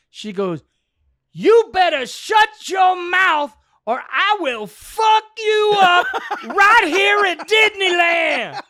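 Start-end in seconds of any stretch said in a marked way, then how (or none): 1.3-8.6 put-on high, loud furious shout at a child; a mother losing it, shrieking; retold scream. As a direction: scream the threat, much higher and louder. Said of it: "she screams at him".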